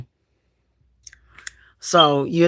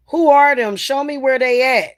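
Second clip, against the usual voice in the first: bold, loud challenge, pitched up; mock bravado; 6 semitones higher, 4 dB louder than usual. To a client, boldly daring the spirits, loud. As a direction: dare the spirits to show themselves. Retelling what he tells clients.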